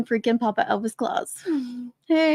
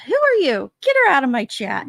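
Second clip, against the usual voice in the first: mock outrage; 3 semitones higher, 6 dB louder than usual. Streamer fake-scolds a viewer who teased her, loud mock outrage. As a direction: pretend to be offended, then laugh it off. No, I'm kidding.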